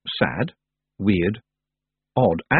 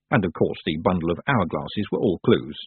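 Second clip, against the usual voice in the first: plain reading voice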